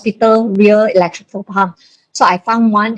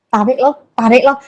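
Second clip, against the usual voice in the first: ordinary talk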